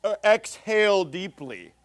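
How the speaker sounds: slow, drawn-out mock lecturer voice; mimicked preacher teaching. Mocking a colleague defining a Hebrew word, slow exaggerated teacher voice. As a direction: draw out each word as if explaining to toddlers. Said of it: "and then he just says".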